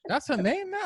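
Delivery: high, incredulous retold question; baffled. Host reacting to the artist's new name, high mock bafflement. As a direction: replay your confusion in a high voice. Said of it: "I was confused until I looked at it".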